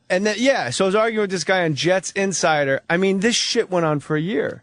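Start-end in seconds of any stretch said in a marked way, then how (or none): none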